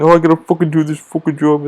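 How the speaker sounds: mock complaining in a put-on voice; lightly put-on grumbling; imitated cursing gripe. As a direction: imitate a coworker griping about work. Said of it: "One guy's cursing at his other friend".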